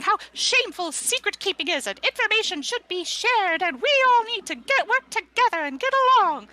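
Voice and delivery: preachy harangue, high and loud; Backyard Biology. Acted. Book repeating her rant to the council, shrill and insistent. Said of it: "she just keeps repeating".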